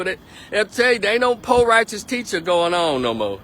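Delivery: ordinary talk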